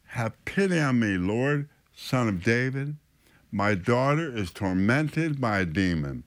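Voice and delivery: ordinary talk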